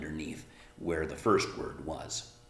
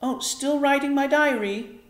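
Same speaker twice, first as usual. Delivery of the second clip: put-on much higher young girl's voice; girl's answer; 8 dB louder than usual. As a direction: answer lightly like a little girl. Arrietty answers her mother.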